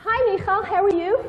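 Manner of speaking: high, cheerful mother greeting. Acted. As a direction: greet your daughter warmly at the door. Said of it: "Let's show you how you should come home".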